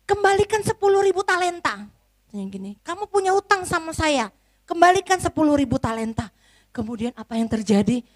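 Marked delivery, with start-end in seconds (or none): 0.1-1.9 a king demanding his money, higher and louder; stern king. Acted. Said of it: "apa kata rajanya". 2.9-6.3 a king demanding his money, higher and louder; stern king. Acted. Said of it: "apa kata rajanya".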